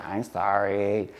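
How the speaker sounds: mumbled low and slow, not meaning it; a sulky kid forced to say sorry; grudging sorry. Retold or imitated. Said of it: "Say it like you mean it".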